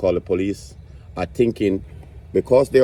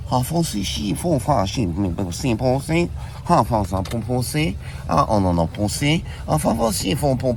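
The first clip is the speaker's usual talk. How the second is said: nonsense syllables in a put-on French-sounding accent; mock foreign gibberish; 2 semitones higher than usual. Comic opening bit, mock French gibberish then translated. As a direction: babble fake French with a sing-song lilt.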